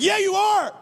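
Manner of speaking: high, crowing comeback; cocky taunt. Retold or imitated. Emboldened assistant coach to retreating fans, high cocky taunt. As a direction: pitch it way up, triumphant.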